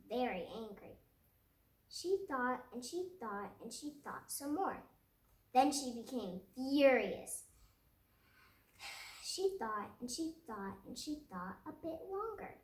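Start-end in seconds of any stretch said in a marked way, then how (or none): 5.5-7.4 a burst of narrated fury, louder; furious. A narrator's reading. Narrator voicing Lily's fury at her teacher, loud and fierce. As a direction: let the anger flare on furious.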